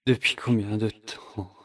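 barely voiced, lost and confused; dazed whisper. A man waking up disoriented, whispering to himself. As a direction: breathe the words as if just coming to.

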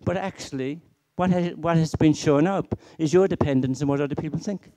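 ordinary talk

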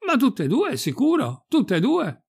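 high-pitched impatient call; shouting back. Acted. Priest outside answering Pirolo at the window, shouting back. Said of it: "gridò l'arciprete".

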